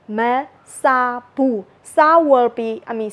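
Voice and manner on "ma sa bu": 1 slow demo. Shown as a demonstration. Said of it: "negative buff particle".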